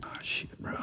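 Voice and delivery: breathy under-the-breath "oh, shit, bro"; whispered curse. Retold or imitated. Driver pulled over by trooper, whispered dismay. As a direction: mutter it to yourself as the cop pulls you.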